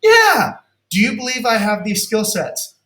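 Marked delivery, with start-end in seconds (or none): 0.0-0.5 imitated client. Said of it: "They'll say, like".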